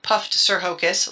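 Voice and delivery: usual speaking voice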